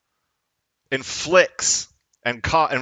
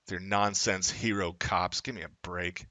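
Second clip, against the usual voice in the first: scornful mutter; 7 semitones lower, 9 dB quieter than usual. Real, not acted. Contemptuous dismissal after rant.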